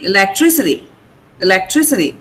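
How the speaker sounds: "Electricity" stressed on TRI, falling low afterwards; stress on tree. Shown as a demonstration. Teacher correcting students, "Electricity" with TRI stressed. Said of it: "the stress is on tree".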